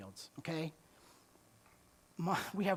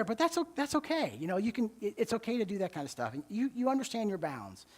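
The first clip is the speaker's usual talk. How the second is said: ordinary delivery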